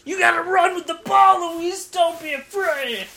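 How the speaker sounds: high, loud coaching from the stands; mock sideline commentator. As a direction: holler advice like an overinvolved fan. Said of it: "all the things I've heard from people commentating".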